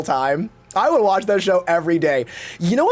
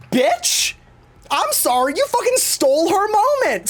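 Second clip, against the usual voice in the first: higher, indignant callout; mock outraged callout; 12 semitones higher than usual. Calling out Hollow for stealing Eva's moment, mock outraged. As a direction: snap back at the moment thief. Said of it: "I'm like".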